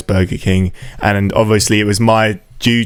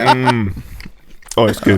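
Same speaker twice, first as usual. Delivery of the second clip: eating; slower than usual. Real, not acted. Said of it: "it sounds like you're doing porn".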